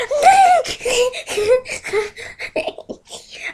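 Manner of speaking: high, squeaky mock giggling; hammed-up childish laughing fit; imitated giggling fit. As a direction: mimic a classmate cracking up. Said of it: "he'll just be like".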